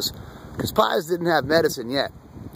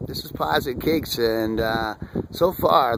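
normal talking voice

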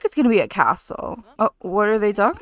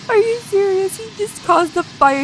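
high, loud are you serious; real disbelief at the fire; shocked disbelief; 10 semitones higher, 4 dB louder than usual. Real, not acted. Reacting to fire.

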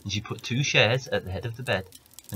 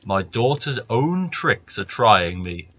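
slow, loud grumbling giant; 6 dB louder, slower than usual. Acted. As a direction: giant cursing his daughter. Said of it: "said the giant".

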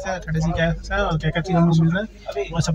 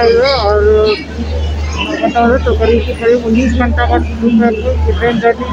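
muffled behind cloth mask; speaking with covered mouth; through a face mask; 5 semitones higher, 6 dB louder than usual. Real, not acted. Seen 3: Grey cloth mask covers mouth and nose throughout; absent in R frames.

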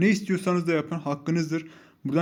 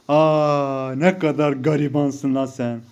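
loud, drawn-out jeer; mock pity; 6 dB louder, much slower than usual. Real, not acted. Mocking a rival player. (yüksek sesle, uzatarak alaycı acıma)

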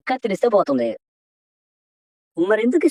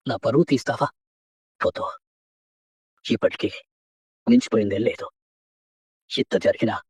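pained plea; 10 semitones lower, 3 dB quieter than usual. Acted. Father pleads with runaway daughter.

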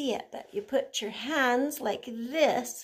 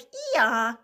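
a quick braying ee-yaw; donkey bray; 4 dB louder than usual. Shown as a demonstration. Explaining a donkey's bray to a child, braying. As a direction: show how a donkey brays. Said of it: "they bray just like".

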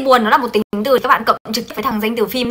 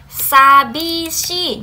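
drawn-out word; 4 semitones higher, much slower than usual. Shown as a demonstration. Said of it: "stretched model word".

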